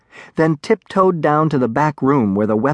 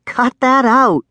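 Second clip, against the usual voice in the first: high, raised-voice reprimand; sharp scolding; 11 semitones higher, 4 dB louder, slower than usual. A narrator's reading. Darek to the pestering dragonling, sharp scolding. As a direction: scold it with a jump in pitch.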